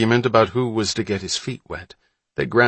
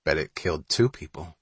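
lower, softer, savouring the thought; with relish; 5 semitones lower, 5 dB quieter than usual. A narrator's reading. Mullen topping his own grisly guess, with quiet relish. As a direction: relish the morbid one-upping. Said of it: "Mullen added with relish".

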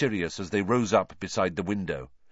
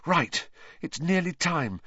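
raised, tense voice; 7 semitones higher than usual. A narrator's reading. Hermione to Harry as the time nears, tense. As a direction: say it with nerves about the time. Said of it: "said Hermione tensely".

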